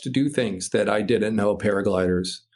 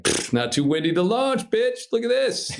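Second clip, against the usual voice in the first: cocky brush-off; 11 semitones higher than usual. Retold or imitated. Pilot mocks the instructor's warning, high and cocky. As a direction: pitch jumps high on a scornful, swaggering dismissal.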